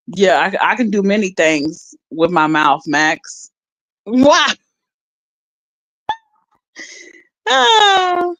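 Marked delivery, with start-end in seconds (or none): 4.1-8.3 high rising squeal, then a cry; playful squeal. Pretend. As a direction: let out a silly squeal.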